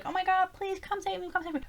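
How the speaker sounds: high, soft pleading; mock begging. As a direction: plead for rescue in a small, high voice. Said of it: "begging someone to come save her".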